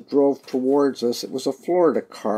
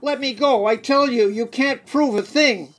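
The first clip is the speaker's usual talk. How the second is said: higher, shouting to be let go; desperate protest; 9 semitones higher than usual. Acted. Mike yelling at police as they seize him. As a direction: struggle and yell at the captors.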